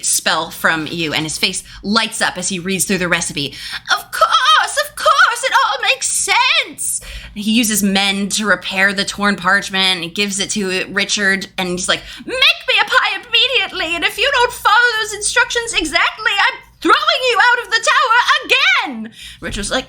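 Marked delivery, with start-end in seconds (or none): 3.9-7.0 put-on delighted old wizard. Delighted wizard reads recipe. 12.2-19.1 put-on bossy old wizard. Wizard orders servant.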